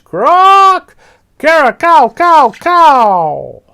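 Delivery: very high, loud squawking screech; parrot screech. Acted. Papagayo screeching from the branch, loud high parrot screech. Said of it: "began to screech".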